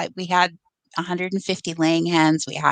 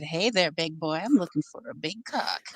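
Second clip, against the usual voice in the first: hen voice; 3 semitones lower, 7 dB quieter than usual. Retold or imitated. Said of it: "in the hen voice".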